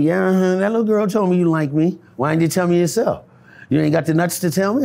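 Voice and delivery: high, loud teasing challenge; voicing sassy woman. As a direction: tease him, sassy and loud.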